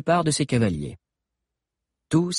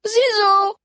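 shrill high call, louder; high little voice crying out; 5 dB louder than usual. A narrator's reading. A little girl cries out to Zizo across the street, high and loud. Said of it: "cria soudain une petite voix aiguë".

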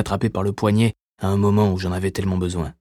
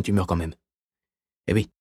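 ordinary delivery, nothing marked

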